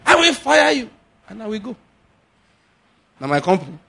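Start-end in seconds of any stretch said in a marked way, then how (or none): 0.1-1.8 mock boss threat. Preacher plays the boss threatening to fire the worker. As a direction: bark the threat like an angry boss.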